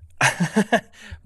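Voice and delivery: laughing. Real, not acted. Laughing at the joke.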